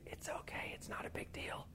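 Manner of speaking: hushed, voiceless whisper of temptation; sin's whisper. Acted. Preacher voicing sin's coaxing whisper to believers, whispered. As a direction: breathe it like a sly little voice in your ear. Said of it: "that little voice that you hear whispering".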